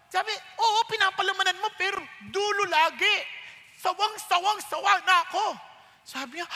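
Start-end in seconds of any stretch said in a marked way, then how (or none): 0.3-5.5 higher, mock-exasperated wife voice; hammed-up complaining wife; fed-up wife. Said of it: "Galit na galit". (asawang babaeng naiinis, pabirong reklamo)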